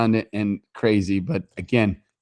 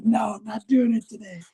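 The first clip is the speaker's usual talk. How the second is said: much higher mock-wife refusal; mimicking wife; 11 semitones higher than usual. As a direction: play his wife, huffy and done for the day. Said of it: "She's like".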